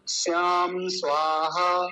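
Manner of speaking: ordinary delivery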